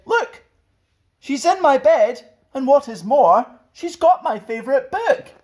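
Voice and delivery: high, squeaky little bear voice; Baby Bear. Acted. Baby Bear tells the others about the girl, squeaky high. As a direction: whine it like a tiny wronged cub.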